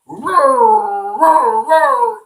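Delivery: loud drawn-out doggy roar. Acted. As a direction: imitate a big dog sounding off, loud and high. Narrator voices the dog below.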